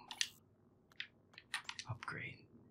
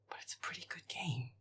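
ordinary talk

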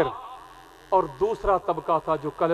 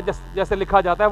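normal talking voice

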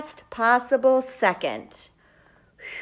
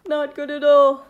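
higher and louder, alarmed; worried; 4 semitones higher, 5 dB louder than usual. Acted. Seed character reacts to the giant, alarmed. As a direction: sound truly alarmed.

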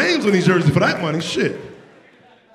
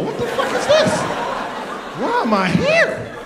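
high, slow disbelieving whine; incredulous squeal; slower than usual. Retold or imitated. Dad at scoreless kids game, high baffled whine. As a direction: stretch it out, baffled and laughing.

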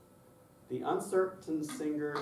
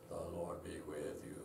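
low, soft liturgical drone; quiet, low monotone speaking voice; monotone bishop; 6 semitones lower, 11 dB quieter than usual. Retold or imitated. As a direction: imitate a bishop droning the Mass. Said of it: "He did the whole Mass like this".

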